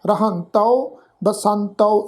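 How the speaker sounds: "ਰਹੰਤੋ", "ਬਸੰਤੋ" slowly, nasal middle and final au; demonstrated pronunciation. Shown as a demonstration. Teacher showing how to recite both words, slow careful pronunciation. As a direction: draw out the au ending of "ਰਹੰਤੋ" and "ਬਸੰਤੋ". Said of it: "ਇਸ ਢੰਗ ਨਾਲ ਪਾਠ ਉਚਾਰਨ ਕਰਾਂਗੇ".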